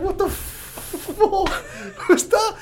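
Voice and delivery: high, drawn-out squeal of bewilderment; laughing disbelief. Retold or imitated. Man waking after being punched, high and helpless with disbelief. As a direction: gasp it out slowly through laughter.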